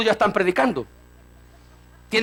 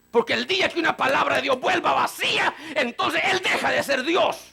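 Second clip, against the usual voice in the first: preaching exclaim; 6 semitones higher than usual. Acted. Preaching climax.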